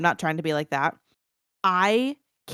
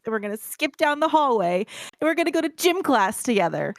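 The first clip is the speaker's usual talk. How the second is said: higher, singsong mocking lilt; mock carefree schoolgirl; 7 semitones higher than usual. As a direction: parody a giddy girl planning fun. Mocks book's carefree schoolgirl tone.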